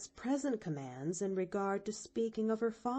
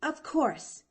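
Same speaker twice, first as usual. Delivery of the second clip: high emphatic "course", falling; an emphatic nod in the voice; 8 semitones higher, 5 dB louder than usual. Acted. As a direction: stress "course" firmly and brightly. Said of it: "nodded Pollyanna emphatically".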